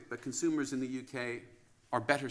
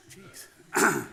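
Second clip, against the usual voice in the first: a sudden, louder jeez, taken aback by his own image; startled jeez; 6 semitones higher, 7 dB louder than usual. Real, not acted. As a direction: blurt it out, caught off guard. Seeing himself on screen.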